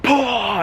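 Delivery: high, burst-out "pah"; mock power-move exclamation; superpower blast noise. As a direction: imitate a superhero blast with a breathy mouth sound. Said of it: "I just do, like, my super just".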